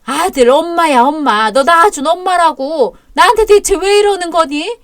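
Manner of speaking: tearful pleading. Acted. Mother-in-law pleading with son.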